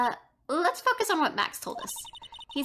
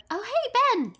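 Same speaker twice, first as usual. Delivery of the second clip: bright high hello; excited greeting; 8 semitones higher than usual. Acted. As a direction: call out cheerfully. Calling out greeting.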